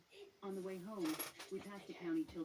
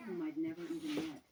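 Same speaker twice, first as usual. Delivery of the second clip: ordinary delivery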